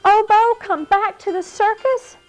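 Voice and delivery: high, breathless delight; excited. Acted. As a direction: squeal with happy surprise. Thrilled by news of Obo.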